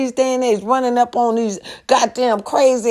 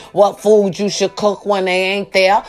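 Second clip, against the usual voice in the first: ordinary talk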